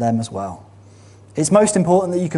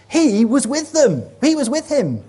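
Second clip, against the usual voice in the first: put-on servant girl accusing; 12 semitones higher, 4 dB louder than usual. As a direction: tell the crowd firmly, much higher. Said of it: "another servant girl says to the crowds".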